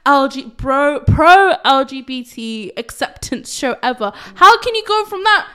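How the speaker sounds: loud, high, laughing outrage; outraged rant. Real, not acted. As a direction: rant in disbelief, half laughing. Angry rant about the actor.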